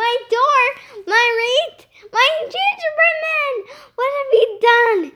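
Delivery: high, loud shocked wailing; screamed outrage. A narrator's reading. Missus Finnegan seeing her broken door, screamed outrage. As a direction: exclaim in horror at each loss. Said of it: "she screamed".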